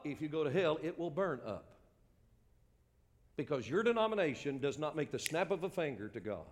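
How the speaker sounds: ordinary talk, nothing marked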